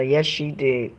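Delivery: normal talking voice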